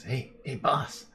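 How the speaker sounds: sneaky minion handing over the goods; lightly voiced lackey bringing news to his boss; henchman voice. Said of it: "Comes up, he says".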